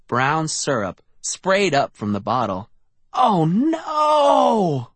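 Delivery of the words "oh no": high panicked cry of dismay. A narrator's reading. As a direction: cry out in horror. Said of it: "I uttered a cry".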